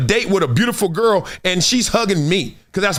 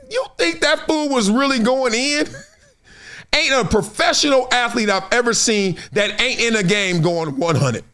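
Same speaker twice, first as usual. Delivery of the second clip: normal talking voice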